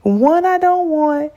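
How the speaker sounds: high, strained mock wail; imitating herself sobbing over two bad options; retold crying. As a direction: whine it out like a crying fit. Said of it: "I cried 'cause I was like".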